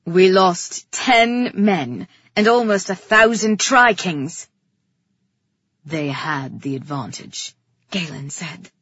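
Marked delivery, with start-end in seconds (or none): 0.0-4.5 loud, slow, emphatic report; forceful report. A narrator's reading. Brother restating losses to the king, forceful and loud. 5.8-7.5 low, controlled male voice; restrained brother. A narrator's reading. Brother defending his men to the king, restrained.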